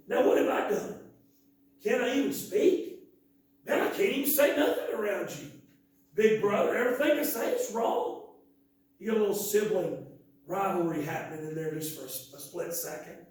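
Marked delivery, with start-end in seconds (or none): 0.1-8.3 higher and louder, a whiny protest at big brother; indignant kid brother. Acted. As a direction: push back like a fed-up little brother. David snaps back at big brother.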